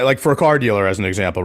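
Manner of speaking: ordinary delivery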